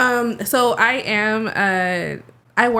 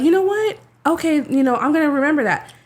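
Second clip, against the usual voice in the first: higher, bright mock-excited coworker; imitating excited coworker; 6 semitones higher than usual. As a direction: play the coworker happily agreeing, pitched up. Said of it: "she was like".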